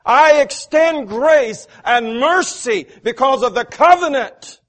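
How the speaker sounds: loud, high, full-force preaching; rising preacher's cadence. Acted. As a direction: drive it home from the pulpit. Climactic preaching.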